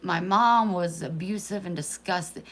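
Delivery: a daughter voicing her mother's anguished prayer, loud and pained; desperate plea to God. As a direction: voice her mother's raw despair, pleading upward. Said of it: "And then you're like".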